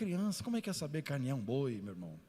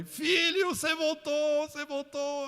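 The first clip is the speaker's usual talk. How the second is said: mother's joyful cry; 7 dB louder, slower than usual. Retold or imitated. Mother greeting her returning son.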